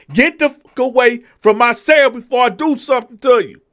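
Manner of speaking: high, loud retold threat; threatening inmate. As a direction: warn him off your cell.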